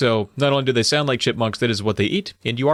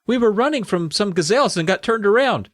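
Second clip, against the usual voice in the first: put-on girl voice; 8 semitones higher than usual. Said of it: "Audra told him".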